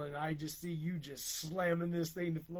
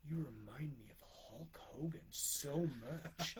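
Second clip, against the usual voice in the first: slow, hushed gush; mock awed whisper; 2 semitones lower, 8 dB quieter than usual. Storyteller imitates a star-struck cashier, slow awed whisper. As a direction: whisper it slowly, as if overcome. Quoting an overcome fan.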